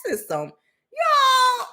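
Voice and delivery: high shouted wail, half laugh half cry; laugh-crying disbelief. Retold or imitated. Overwhelmed by the news, wailing in laughing despair. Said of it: "I'm like".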